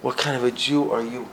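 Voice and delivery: soft puzzled echo; quiet wondering. Retold or imitated. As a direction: ask yourself quietly. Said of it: "knew nothing about".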